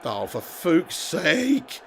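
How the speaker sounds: slow, soft, defeated groan of a line; muttered despair. Real, not acted. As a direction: gamer watching his defence collapse, slow quiet despair. Goal conceded while streaming.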